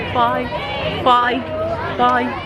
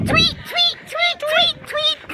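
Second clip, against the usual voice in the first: high squeaky bird tweets; parakeet chatter; slower than usual. Pretend. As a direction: chirp like a little parakeet. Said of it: "Do that again".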